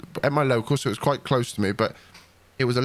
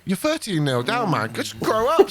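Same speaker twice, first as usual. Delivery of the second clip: mock scolding; 8 semitones higher, slower than usual. Telling off manager, retold.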